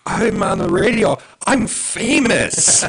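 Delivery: put-on high, loud little kid voice; excited child voice. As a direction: imitate a proud kid bragging. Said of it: "He was like".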